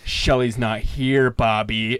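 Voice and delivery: slow, low, loud menacing drawl; imitating Leo's menace. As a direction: stretch it out low and threatening. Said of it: "the inflection of his voice".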